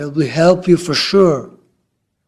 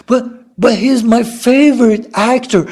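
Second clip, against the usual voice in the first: higher, louder, gushing protest; excited fan; 6 semitones higher, 5 dB louder, slower than usual. Acted. Fan protests to a calming friend, excited.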